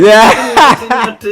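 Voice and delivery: laughing hard at the clip; howling laugh. Real, not acted. Reacting to a video, he bursts into howling laughter.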